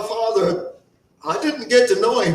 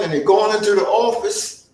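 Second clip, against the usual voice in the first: ordinary delivery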